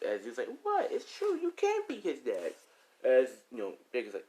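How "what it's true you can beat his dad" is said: put-on high-pitched kid's protest; protesting child. Child insisting to mother about dad, high kid's protest.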